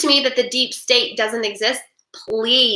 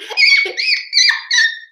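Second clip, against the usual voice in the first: high laugh; 4 dB louder than usual. Real, not acted. Laugh after rant.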